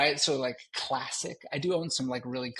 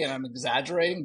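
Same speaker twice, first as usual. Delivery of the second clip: usual speaking voice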